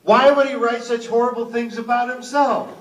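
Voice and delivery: high, loud mock-indignant woman; confused woman. Comic retelling a woman's question about Sam Raimi, confused and loud. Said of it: "She was very confused".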